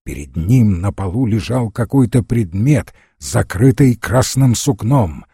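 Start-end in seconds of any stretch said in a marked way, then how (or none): none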